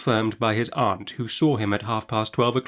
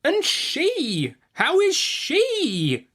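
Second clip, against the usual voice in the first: much higher, drawn-out, demanding; sharply; much slower than usual. A narrator's reading. The aunt demands news of the baby girl, sharply. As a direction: press him hard for the answer. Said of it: "said my aunt sharply".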